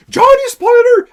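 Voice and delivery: cri aigu et fort pour dénoncer un spoiler; cri moqueur. Retold or imitated. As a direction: crie au spoiler comme un spectateur fâché. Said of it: "vous êtes comme". (le public crie au divulgâcheur, très fort et aigu)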